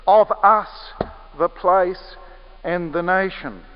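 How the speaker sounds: emphasis on "Of us" placed first; stress on OF US. Shown as a demonstration. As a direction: lean hard on "Of us" before easing off. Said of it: "there's an emphasis on the word our".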